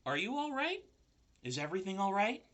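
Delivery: put-on high, small baby spider voice; squeaky little spider children greeting the pig; bright tiny voices of baby spiders. A narrator's reading. Spider babies greet Wilbur.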